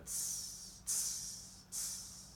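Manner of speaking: unvoiced "tss" like a cymbal; sharp repeated beatbox tss; imitated hi-hat hiss. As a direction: mouth a hi-hat beat in spaced hisses. Imitates club beat between words.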